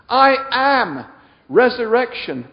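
loud, high declaration; preacher's proclamation. Acted. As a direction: proclaim it to the whole room.

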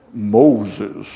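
deep, booming bush calling out; low and loud, summon him from the flames; voice of the burning bush. Acted. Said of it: "if that bush spoke to me".

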